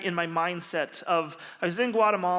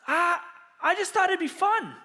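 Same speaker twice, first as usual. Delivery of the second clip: high-pitched, innocent-sounding excuse; light, high mock-sheepish voice; playful excuse; 9 semitones higher than usual. Sheepish excuse to his students.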